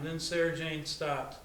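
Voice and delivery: ordinary delivery, nothing marked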